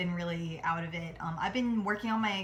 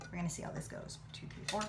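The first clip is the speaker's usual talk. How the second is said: whispered countdown, tense; nervous whisper; 8 dB quieter than usual. Real, not acted. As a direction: hush it out while flipping the pan. Nervous countdown before flipping flan.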